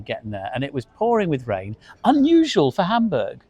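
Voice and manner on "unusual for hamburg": sarcastic. Real, not acted. Sarcastic aside about Hamburg rain.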